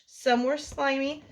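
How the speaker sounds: usual speaking voice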